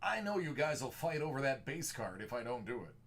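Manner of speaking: big movie-trailer announcer read; projected movie voice. Acted. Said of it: "projecting the movie voice".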